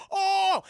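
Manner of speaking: loud, high shocked cry; hammed-up shock. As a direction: belt out an exaggerated "oh". Acted shock at reveal.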